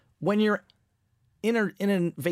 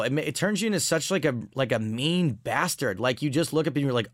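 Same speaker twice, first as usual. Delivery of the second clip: ordinary delivery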